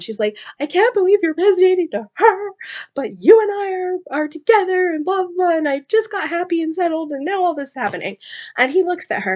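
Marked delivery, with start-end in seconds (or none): 0.6-8.2 high, loud, tumbling-out panic; meltdown. Retold or imitated. Kira panicking that her mate resonates with another, high and loud. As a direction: wail it out like she's falling apart. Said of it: "Kara's, like, having a meltdown".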